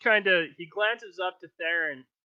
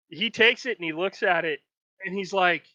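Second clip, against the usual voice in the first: ordinary talk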